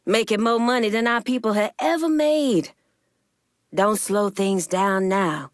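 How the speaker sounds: ordinary talk, nothing marked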